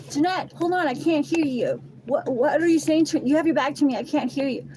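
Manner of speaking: imitating the other woman calling after her; mock calling after.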